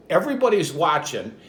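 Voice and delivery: normal talking voice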